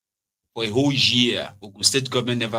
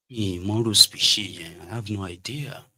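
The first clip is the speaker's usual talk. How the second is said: ordinary delivery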